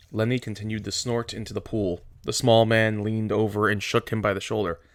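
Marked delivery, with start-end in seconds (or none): none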